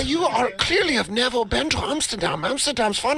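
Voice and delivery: sing-song mock voice. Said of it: "I say".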